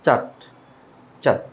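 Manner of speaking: চার্ট with silent R, a long 'chaat'; British pronunciation. Shown as a demonstration. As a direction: say চার্ট British-style, dropping the R. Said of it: "হবে না, এটাকে বলতে হবে". (চার্ট, র উচ্চারণ ছাড়া British উচ্চারণে)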